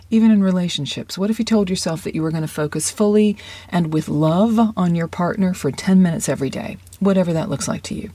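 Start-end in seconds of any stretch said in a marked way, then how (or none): none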